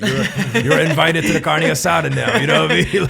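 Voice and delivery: high, loud, laughing approval; imitating rowdy, won-over commenters; excited fan imitation. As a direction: hype it up like a delighted commenter, voice climbing. Quoting delighted commenters' approval.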